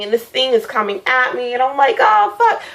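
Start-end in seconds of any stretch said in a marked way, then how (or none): 2.0-2.6 a much higher, alarmed yelp; startled high cry. Retold or imitated. Retelling the monster charging at her, startled high yelp. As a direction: yelp as the thing lunges. Retold fright as monster comes.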